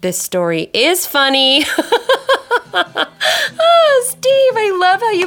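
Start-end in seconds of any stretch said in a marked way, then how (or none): 1.7-2.7 high burst of laughter; delighted high laugh. Real, not acted. Laughs at the story. 2.7-5.3 laughing. Real, not acted. Laughs at the story.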